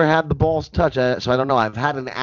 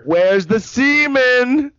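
high, slow put-on voice; campy mock voice; 10 semitones higher, 3 dB louder, much slower than usual. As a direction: drag the question out in a silly high voice. Mock voice continuing the bit.

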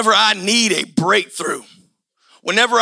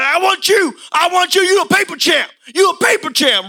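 jab him with mocking taunts; taunting boxer; 6 semitones higher than usual. Rival boxer taunting Rocky.

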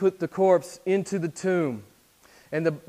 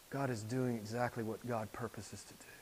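whisper; 4 semitones lower, 11 dB quieter than usual. Acted. Sudden whisper after loud preaching.

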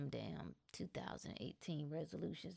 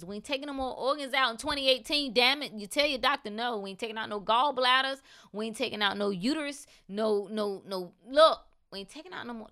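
loud rant; 4 semitones higher, 9 dB louder than usual. Real, not acted. Ranting about surgeries to viewers.

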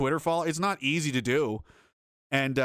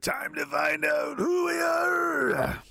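dramatic shout; 13 semitones higher, much slower than usual. Acted. Reading super chat dramatically.

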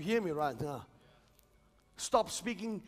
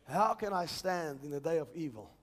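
ordinary delivery

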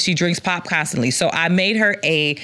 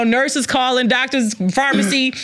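normal talking voice

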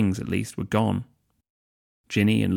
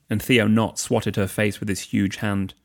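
ordinary delivery, nothing marked

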